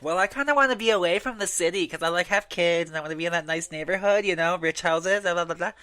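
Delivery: hammed-up suburban parent; mock suburbanite. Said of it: "and you're like".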